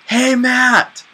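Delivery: high call of his name; a stranger yelling across the mall. Retold or imitated. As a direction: friendly shout of a name. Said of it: "They're like".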